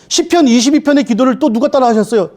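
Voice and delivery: ordinary talk, nothing marked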